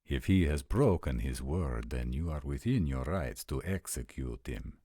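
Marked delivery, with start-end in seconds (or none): none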